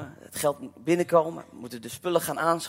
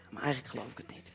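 inner doubt; 5 semitones lower, 13 dB quieter, faster than usual. Retold or imitated.

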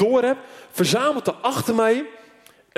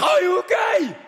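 shouting across the noise; 11 semitones higher than usual. Retold or imitated. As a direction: yell it to someone who can't hear you. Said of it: "heen en weer te schreeuwen".

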